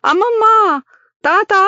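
screaming high and loud for help; a scared child shrieking for grandma; girl frightened by dragonflies, shrieking for her grandparents; frightened cry. A narrator's reading. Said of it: "అని అరుస్తూ ఏడుస్తూ".

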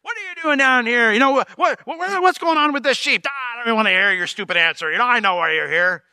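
high, mocking big brother rant; scoffing big brother. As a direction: talk down to your kid brother. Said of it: "you gotta love this".

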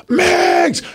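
high shouted name like a cheering fan; fans screaming. Retold or imitated. Fans spot him at the meet and greet, mock fan scream. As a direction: yell it out like an excited fan. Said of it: "Everyone's coming up to me screaming".

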